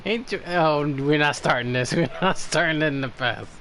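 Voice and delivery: usual speaking voice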